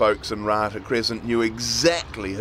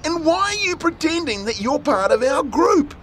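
put-on boy demanding; 3 dB louder than usual. A narrator's reading. Tim demands answer.

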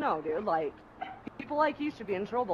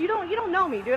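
angry; 10 semitones higher, 3 dB louder than usual. Real, not acted. Angry high outburst.

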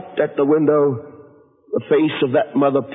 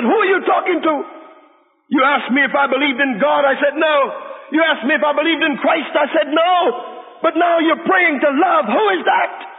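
put-on confused drunk son; 12 semitones higher than usual. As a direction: demand an answer, half shouting. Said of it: "He said".

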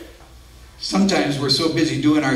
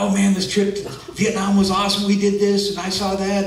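excited bragging; 5 semitones higher than usual. Retold or imitated. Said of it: "I just want to talk about".

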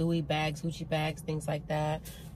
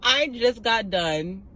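numb mouth after dental work; talking with a numb, slack jaw; numb from the dentist; 5 semitones higher, 5 dB louder, much slower than usual. Real, not acted. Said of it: "This whole side of my face is numb".

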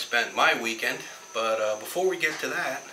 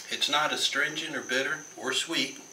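ordinary talk

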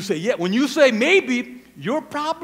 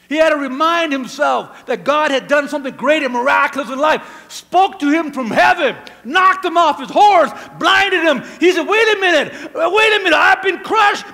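sermon climax, loud and high, last words stretched out; full-force preaching; 5 semitones higher, 9 dB louder than usual. Acted. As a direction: build from raised voice to high shouting.

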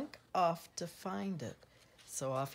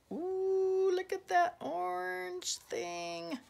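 awed wonder; 11 semitones higher than usual. Pretend. Reader pointing out the orange thing, awed wonder. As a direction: marvel in a much higher voice.

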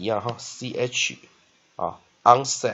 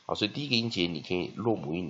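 ordinary delivery

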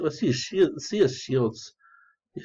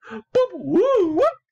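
high-pitched Curly noises, louder; Three Stooges Curly; 4 dB louder, much slower than usual. Retold or imitated. Streamer mimicking Curly from the Three Stooges, high goofy yelps. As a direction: do Curly's goofy boop-woo-whoop. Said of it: "I even look like Curly".

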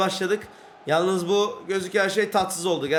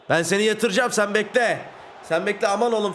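usual speaking voice

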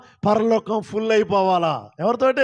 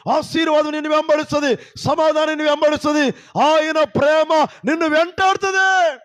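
fiery preaching; 6 semitones higher, 4 dB louder than usual. Real, not acted. Pastor exhorting the congregation, loud fervent preaching. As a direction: proclaim it to the whole church.

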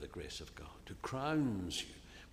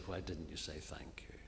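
mock rebuke; 5 semitones lower, 9 dB quieter than usual. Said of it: "rebuke them and say".

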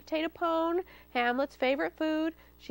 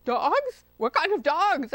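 high, loud startled question; alarmed; 4 semitones higher, 6 dB louder, slower than usual. A narrator's reading. Fox hears of dogs barking, alarmed. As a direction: jump on it in alarm.

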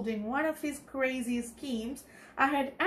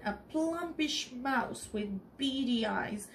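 ordinary delivery, nothing marked

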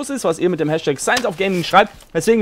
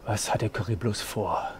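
low, softening suspense reading; hushed storyteller building suspense; story narration; 6 semitones lower, 6 dB quieter than usual. Acted. Reads fanfiction aloud.

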